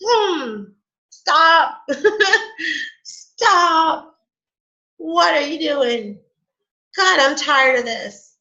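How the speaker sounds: high, hammed-up plea to stop; exasperated energy. Energy telling her to stop, hammed-up exasperation. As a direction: beg a halt in mock exasperation. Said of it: "it's like the energy just like".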